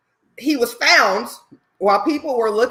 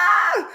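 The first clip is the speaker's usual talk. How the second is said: shriek; 10 semitones higher than usual. Real, not acted. Shriek at real glass.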